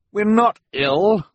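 put-on high, loud boy's protest; crying out. A narrator's reading. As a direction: cry it out slowly and high. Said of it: "Klaus cried".

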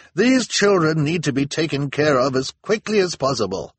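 high, loud, urgent demand; impatient townswoman. A narrator's reading. Missus Morrow urges the council on, indignant urgency. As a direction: demand action at once.

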